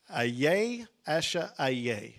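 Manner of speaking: "Ayeh Asher Ayeh" drawn out slowly, each word deliberate; slow Hebrew reading. Shown as a demonstration. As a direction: read "Ayeh Asher Ayeh" slowly, like a sacred name. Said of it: "it calls Moses Moshi".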